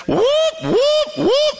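high rising alarm whoops; playful siren imitation. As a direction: mimic a warning siren, way up high. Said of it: "the alarms are going off".

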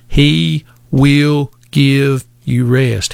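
very slow, word by word; slow, emphatic promise. Real, not acted. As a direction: space out each word for weight. Preacher stressing a promise word by word.

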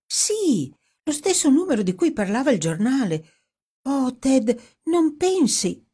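high, hurried, then stretched-out appeal; high-pitched, urgent wonder; excited insistence. Acted. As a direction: press the point breathlessly eager. Dorothy presses her amazing hunch.

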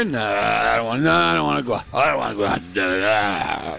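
low, groaning reluctant grandkid; mock teenage whining. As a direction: drone the refusal like a sulky teen, trailing into a groan. Voicing grandkids refusing to go out.

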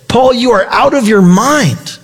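slow, high-pitched retold exclamation; mock loud outburst. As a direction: imitate a governor's loud interruption, drawing it out high. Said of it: "says in a loud voice".

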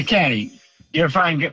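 normal talking voice

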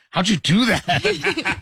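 high, drawn-out mock amazement; slow, high-pitched disbelief; amazed reaction. Reacting to a text vanishing from the phone, amazed.

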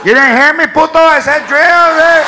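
loud, irritated Chicano intercom voice; angry Chicano announcement. Pretend. The announcer scolds a shopper over the intercom, angry Chicano accent. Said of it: "announcements have accents".

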